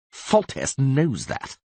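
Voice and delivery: slow scornful snort; snorting scornfully. A narrator's reading. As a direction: drag it out with a sneer. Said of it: "snorted Vizimir".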